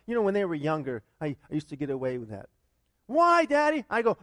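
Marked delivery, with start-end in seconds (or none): 3.1-3.9 imitating a kid. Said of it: "It's like I tell my kids when they go".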